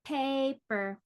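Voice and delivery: "Paper" in two even beats; measured, separated syllables in "Paper"; split into syllables. Shown as a demonstration. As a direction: say "Paper" as pa, per. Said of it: "How many syllables?".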